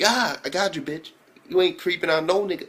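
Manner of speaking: loud, high-pitched crowing callout; mock gotcha. He catches the girl creeping after him, mock gotcha. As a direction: blurt it out high, like catching someone red-handed.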